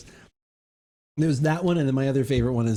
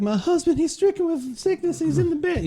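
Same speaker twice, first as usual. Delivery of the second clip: farm wife voice. Retold or imitated. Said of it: "He's like".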